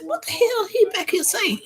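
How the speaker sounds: high, baffled outburst; startled. Retold or imitated. Mother hearing her son speak Spanish, high baffled outburst. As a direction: blurt it out in amazement. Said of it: "listen, I said".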